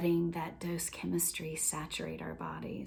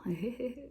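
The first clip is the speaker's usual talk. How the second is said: giggle; 6 semitones higher, 6 dB quieter than usual. Shown as a demonstration. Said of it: "you can giggle".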